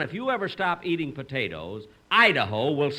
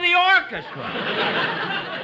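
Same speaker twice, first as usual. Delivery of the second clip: laughing; 7 dB louder, much slower than usual. Real, not acted. Host stops singer starting early.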